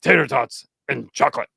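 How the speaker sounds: lightly voiced hungry monster; Venom. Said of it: "I start doing Venom's voice".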